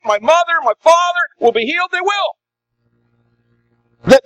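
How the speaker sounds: high, eager and urgent, pleading for prayer; fervent believing plea. Retold or imitated. A sick man's relative on the phone begging the pastor, high and pleading. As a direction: beg with rising certainty. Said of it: "they don't say it like this".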